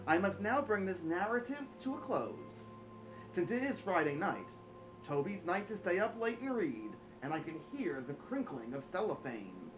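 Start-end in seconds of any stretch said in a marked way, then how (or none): none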